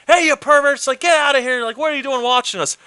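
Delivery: high, loud mock outrage at the perverts; startled man shouting at the couple; angry neighbor yelling. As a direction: yell it up high like a scandalized onlooker. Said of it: "the guy's like".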